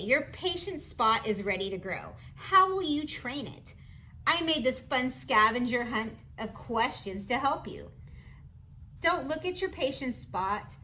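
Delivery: usual speaking voice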